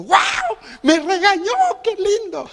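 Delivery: high, far-off giddy squeal of joy; mock delight. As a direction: gush over being scolded like a thrilled fan. Delighted to be scolded by apostle. (chillido de alegría fingida, muy agudo)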